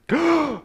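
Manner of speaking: big theatrical gasp of surprise; mock gasp. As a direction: suck in air in pretend amazement. Acts opening the imaginary present.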